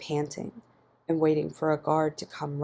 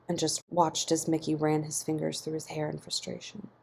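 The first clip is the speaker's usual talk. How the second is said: usual speaking voice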